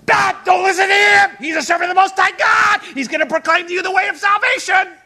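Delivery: high, loud hollered proclamation; crying out. Acted. Heckler butting into a workplace chat, crying out loudly. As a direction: holler it over everyone's conversation. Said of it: "they're crying out".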